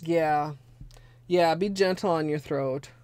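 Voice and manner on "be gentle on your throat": ordinary talk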